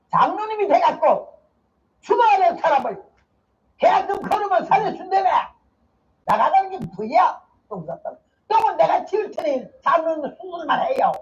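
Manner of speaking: high, insistent rebuke of the elder; a feisty deaconess demanding surgery; forceful scolding. Retold or imitated. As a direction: scold the elder to his face. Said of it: "얼마나 펀치가 강한지, 말 펀치가".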